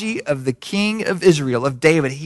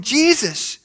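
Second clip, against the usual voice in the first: imitated crying out; 8 semitones higher than usual. Blind men really into it, calling out high to Jesus. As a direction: call the name with mock urgency. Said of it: "they're into it".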